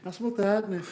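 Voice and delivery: mock Swedish boy journalist, eager; Swedish kid accent. Mocking the fanzine kid in a light Swedish accent. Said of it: "This is my Swedish twelve-year-old accent".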